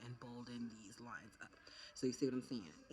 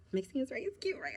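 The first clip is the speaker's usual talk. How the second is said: very high, loud cute question; high squeaky; 12 semitones higher, 5 dB louder than usual. Real, not acted. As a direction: squeal it up playfully. Asking viewers, very high.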